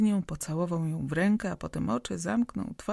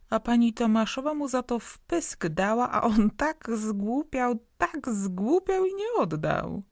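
put-on cheeky boy taunting; 6 semitones higher, 4 dB louder than usual. A narrator's reading. Boy mocking the woman.

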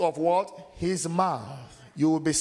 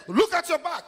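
much higher, louder alarmed call; shout; 5 dB louder than usual. Retold or imitated. Bystander alerting the wounded man, a loud alarmed shout. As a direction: shout a warning across the street. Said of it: "You say".

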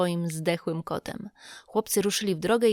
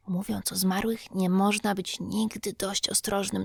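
ordinary delivery, nothing marked

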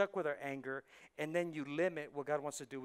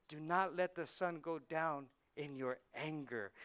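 normal talking voice

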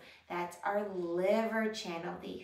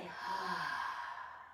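long open-mouthed sigh, softer; dramatic sigh; 6 dB quieter than usual. Shown as a demonstration. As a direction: let out a big audible sigh. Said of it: "breathe out with a big sigh".

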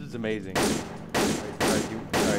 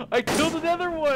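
excited. Real, not acted. Gleeful kill in game.

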